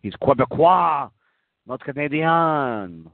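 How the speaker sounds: hammed-up French Canadian accent. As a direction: exaggerate "Quebecois" and "Canadian" as French, drawing out the last syllable. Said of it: "does he speak with a French accent".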